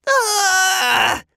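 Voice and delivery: loud, high panicked scream; long shriek of alarm. A narrator's reading. Kids hearing the emergency operator, loud high scream.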